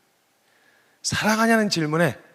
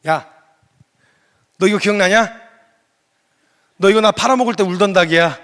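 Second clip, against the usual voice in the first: jokingly voicing Jesus; 4 semitones higher, 8 dB louder than usual. Jesus teasing Peter over chicken.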